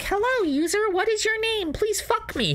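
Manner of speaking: very high, far-off machine voice; robot gun voice. Retold or imitated. Narrator mocks the paintball gun in a high robot voice.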